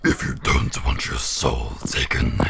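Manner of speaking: low demonic voice; devil voice. Acted. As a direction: menace the listeners in a devil voice. Continuing devil voice.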